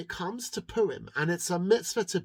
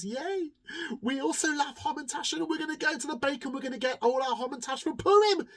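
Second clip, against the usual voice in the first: higher, fast eager cheering townspeople; excited townsfolk; 10 semitones higher, faster than usual. Acted. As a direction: bubble over like happy villagers. Townspeople cheer about treats.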